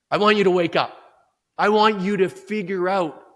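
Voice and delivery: loud, urgent wake-up call after the hush. Real, not acted. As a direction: jolt the listener awake.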